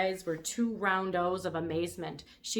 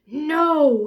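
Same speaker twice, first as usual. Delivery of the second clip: much louder, emphatic refusal; booming no; 9 semitones higher, 15 dB louder than usual. A narrator's reading. Brontosaurus refusing Lulu again, booming. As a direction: boom it out. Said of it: "in his rumbling voice".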